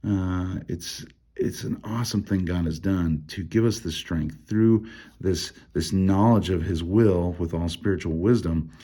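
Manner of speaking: usual speaking voice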